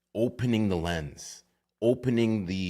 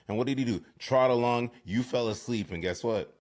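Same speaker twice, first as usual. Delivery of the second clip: ordinary talk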